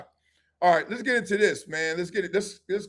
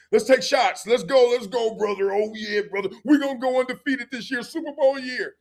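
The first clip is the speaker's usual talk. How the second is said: higher, louder party hype; hyped up; 6 semitones higher, 4 dB louder than usual. Retold or imitated. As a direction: pump up the whole team. Hyped teammates at the bar.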